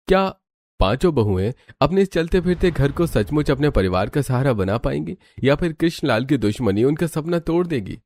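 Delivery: ordinary talk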